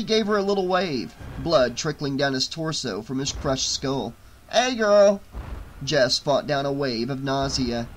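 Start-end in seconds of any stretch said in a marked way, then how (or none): 4.5-5.2 loud, high happy hello; cheery greeting. Acted. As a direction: greet her brightly.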